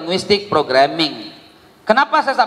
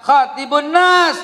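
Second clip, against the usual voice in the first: slow, high, deliberate recitation of the hadith; slow Arabic recitation; 7 semitones higher, slower than usual. Shown as a demonstration. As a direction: recite it slowly for them to repeat. (bacakan hadis dengan lambat dan tinggi)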